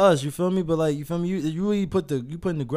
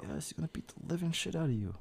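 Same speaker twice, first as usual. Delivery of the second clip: whispering; 4 semitones higher, 11 dB quieter than usual. Real, not acted. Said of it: "Why are you whispering?".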